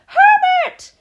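piercing, very loud shriek for help; shrill scream. A narrator's reading. Wife calls her husband across the room, screaming shrilly. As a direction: scream it at the top of the voice. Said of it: "Missus Jenkins' shrill voice".

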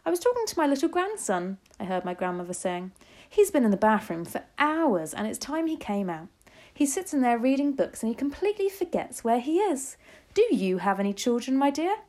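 high, chatty grandmother's voice; lightly voiced grandmother. A narrator's reading. Grandmother explaining to the witch, lightly chatty.

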